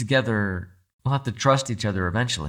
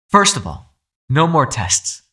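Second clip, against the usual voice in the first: raised, higher and louder; demanding his terms, loud and high; firm demand; 5 semitones higher, 6 dB louder, slower than usual. Acted. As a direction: put your foot down hard.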